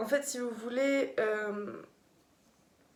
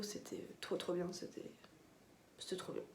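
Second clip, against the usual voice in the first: soft, almost whispered; hushed; 4 semitones lower, 11 dB quieter than usual. Real, not acted. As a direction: breathe it out, barely voiced. Quiet gush about electro act.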